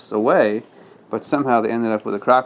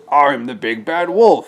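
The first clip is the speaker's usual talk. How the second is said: a touch of a wolf howl, louder; wolf boasting louder; 3 semitones higher, 5 dB louder than usual. Acted. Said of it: "he howled".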